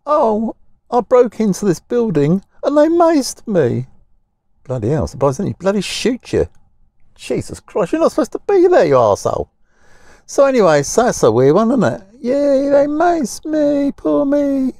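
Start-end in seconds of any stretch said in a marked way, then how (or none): 0.1-3.9 high, whining self-pity; mocking a rioter's high whine; mock whiny complainer. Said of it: "They're complaining". 12.2-14.7 high, slow, sing-song mockery; mock "poor me" whimper, pitched way up; sarcastic mock cheer. Mocking rioters' self-pity.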